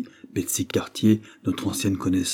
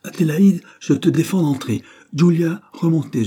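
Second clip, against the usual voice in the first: higher, louder forbidding order; father forbidding his daughter to enter, loud; urgent command; 9 semitones higher, 6 dB louder than usual. A narrator's reading. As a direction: bark it to keep them out. Father ordering family upstairs.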